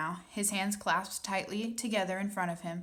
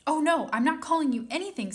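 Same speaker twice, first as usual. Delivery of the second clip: put-on girl's pleading cry, higher and louder; cried out; 8 semitones higher, 7 dB louder, faster than usual. A narrator's reading. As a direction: protest quickly, alarmed. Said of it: "Sophie cried".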